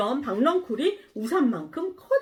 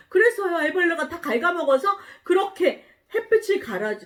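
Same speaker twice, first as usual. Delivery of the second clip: ordinary talk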